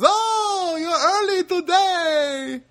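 very high, loud, stretched-out greeting; overjoyed Filipino guy. Retold or imitated. Worker greeting the same customer days later, high and overjoyed. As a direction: exclaim it in a piercing, drawn-out mock delight. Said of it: "and he goes".

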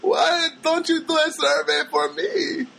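put-on high, slow, coaxing girlish voice; imitating her ask. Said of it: "She goes".

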